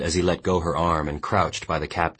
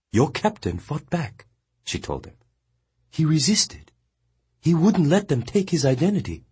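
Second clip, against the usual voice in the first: higher, steady woman's voice; Mongnan consoling; 11 semitones higher than usual. A narrator's reading. Mongnan firmly consoling the weeping boy. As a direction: tell him firmly he died well.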